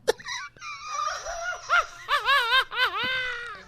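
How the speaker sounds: high, long, crying laugh; real helpless laughter; shrieking laugh. Real, not acted. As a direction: lose it completely. Host collapses laughing.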